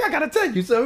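high-pitched prankster voice; Nephew Tommy. Retold or imitated. Prank caller leading up to the reveal, same high voice. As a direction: keep up the put-on high caller voice. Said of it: "in the same voice".

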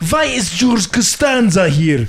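slow, laughing Russian voice, vee for why; hammed-up Russian asking; mock Russian accent.

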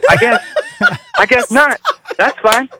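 put-on high, thin voice of a baffled young hire; high, hurt mock protest; high squeaky mock voice. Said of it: "And I was like".

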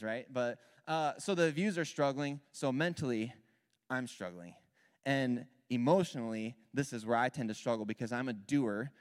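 ordinary talk, nothing marked

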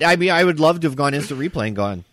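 usual speaking voice

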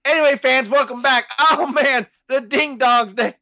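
high, loud mock wrestling commentator; hammed-up announcer struggling on through laughter; Jim Ross. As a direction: sell the nonsense as a high-pitched announcer. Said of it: "like, Jim Ross".

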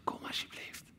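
whispered plea. Acted. God the Father whispering to his children. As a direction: breathe the words as a plea. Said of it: "die zegt".

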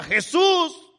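high, loud desperate plea; drawn-out, high-pitched cry for help. Retold or imitated. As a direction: shout it out like calling through a crowd. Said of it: "un hombre que le gritaba".

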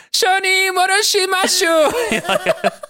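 high, drawn-out mock anime scream; very high, stretched childlike cry. A narrator's reading. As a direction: scream it like shrieking cartoon kids. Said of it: "voices scream".

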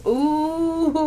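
high, drawn-out ooh of delight; delighted ooh-wee. Real, not acted. Relishing the thought of shower sex, high delighted ooh. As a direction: savour it like a treat.

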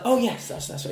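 high, breathy-free overly polite lilt; mock polite waiter. Waiter answering a customer with hammed-up politeness. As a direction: play the eager, servile waiter. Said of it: "I was like".

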